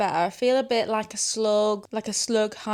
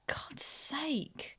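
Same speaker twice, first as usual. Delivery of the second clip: under-breath whisper; annoyed whisper; whispered annoyance; 6 semitones higher than usual. Real, not acted. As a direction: mutter it off mic.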